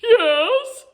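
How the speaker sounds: high, loud squeaky yes; wolf pretending to be Little Red, squeaking. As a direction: fake a tiny granny voice. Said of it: "squeaked the wolf".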